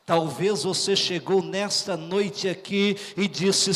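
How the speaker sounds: ordinary delivery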